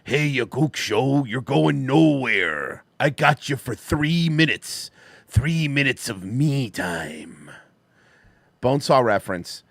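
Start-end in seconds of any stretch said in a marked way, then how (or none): 0.0-7.5 slow, drawn-out hint of Macho Man; Macho Man impression. Quoting Macho Man to a donor, lightly voiced wrestler drawl. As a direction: stretch each word out like a wrestling promo. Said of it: "Macho Man goes".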